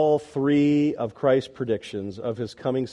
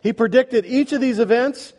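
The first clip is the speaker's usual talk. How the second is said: driving, rapid-fire sermon with punched references; forceful preaching; 2 semitones higher, 4 dB louder than usual. Real, not acted.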